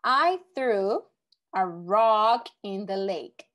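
slow, deliberate read, each word spaced; slow example reading. Shown as a demonstration. Teacher reading the next example to the class, slow and clear. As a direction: read the sentence slowly for the students. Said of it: "Next one".

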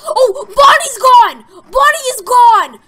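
very loud, high wail of alarm; panicked cry. Real, not acted. Player finds Bonnie missing, panicked wail. Panics at empty game camera.

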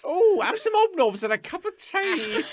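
high-pitched, loud caricature mum; over-the-top English mum offering treats; British mother. Acted. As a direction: fuss and coo in a hammed-up falsetto. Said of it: "like, mother, and you know what it will be like".

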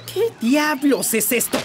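high-pitched indignant outburst; angry demand. Acted. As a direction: confront her, pitch leaping up in outrage. Husband confronts wife over party.

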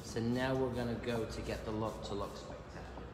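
usual speaking voice

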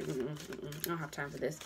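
low, soft dismissive grumble; fed up. Retold or imitated. A reader annoyed at her cluttered desk, muttering low. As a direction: wave the mess away under your breath. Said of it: "and I'm just like".

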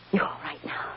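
soft reassuring whisper. Acted. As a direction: hush her gently, under your breath. Mother comforting frightened child.

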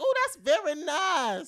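high, loud, slow ooh of delight; mock admiring. Admiring the Gucci shoe, high and slow.

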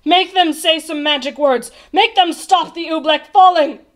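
loud, insistent royal order; commanding. A narrator's reading. The king demands magic words to stop the Oobleck, commanding. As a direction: demand it urgently, as a ruler would. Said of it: "he commanded".

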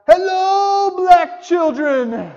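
high, loud, slow shouted greeting; drawn-out yell back; yelled reply. Retold or imitated. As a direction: shout it back cheerfully from the truck. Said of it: "yelled back at them".

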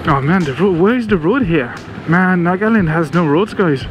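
ordinary talk, nothing marked

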